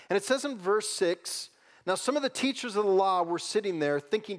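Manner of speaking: loud, high, rising preaching voice; impassioned preaching. Acted. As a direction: build the sermon up to a near shout. Preaching builds to full force.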